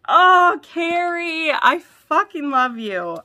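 loud, high, slow gush; ecstatic. Real, not acted. Fan gushing to Carrie over her gift, ecstatic shout. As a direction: shout your love at her.

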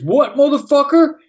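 imitating a guy picking a fight; hammed-up macho "what, motherfucker"; mock tough-guy challenge. Thief squares up.

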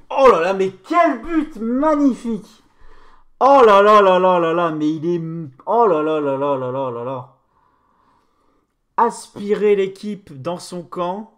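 loud, high ecstatic shouting; excited sports commentary. Real, not acted. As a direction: scream with joy at the goal. Celebrating a stunning goal. (commentaire sportif survolté)

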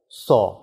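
"so" with a half-closed o, lips rounded. Shown as a demonstration. Teacher to students, showing the half-closed o in "so". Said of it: "发成半闭音o".

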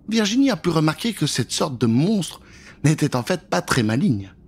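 plain reading voice

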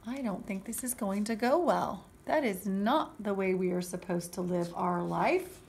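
ordinary delivery, nothing marked

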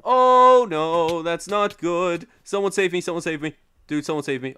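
loud, high panic, opening on a long wail; panicked cry for help. Real, not acted. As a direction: yell for someone to save you. In danger in game, calls for rescue.

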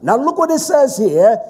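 loud, high, emphatic sermon delivery; full-force preaching. Acted. Preacher thundering at the congregation, full force.

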